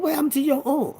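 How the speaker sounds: worried mama. Retold or imitated. Mama sees the arm.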